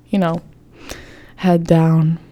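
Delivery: ordinary talk, nothing marked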